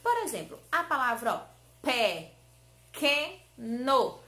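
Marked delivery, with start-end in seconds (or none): 2.9-4.2 PEQUENO loud and slow as pe, que, no; three separate syllables. Shown as a demonstration. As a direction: open up for each syllable of "pequeno". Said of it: "Quantas vezes a tia abriu a boca?".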